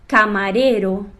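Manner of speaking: Camareiro slow with tapped R; soft R. Shown as a demonstration. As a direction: tap each R of Camareiro lightly. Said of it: "E agora com o R fraco".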